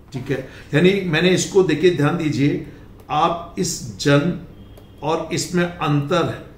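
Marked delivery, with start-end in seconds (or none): none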